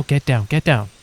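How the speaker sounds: usual speaking voice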